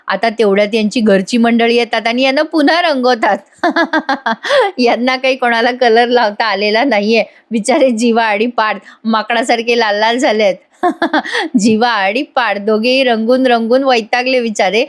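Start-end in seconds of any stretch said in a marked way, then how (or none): 3.6-4.7 high, rippling giggle; amused laugh. Real, not acted. Narrator amused by repeat colouring, high giggle. 10.8-11.5 high, short giggle; quick giggle at their looks; amused laugh. Real, not acted. Narrator amused by red-faced brothers, short giggle.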